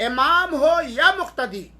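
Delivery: usual speaking voice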